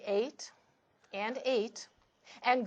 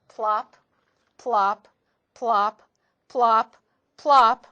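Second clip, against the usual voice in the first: each plop louder than the last; building plops; 8 dB louder, slower than usual. A narrator's reading. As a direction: let the plops grow louder, one after another. Ducklings jumping into the river.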